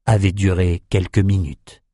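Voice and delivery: plain reading voice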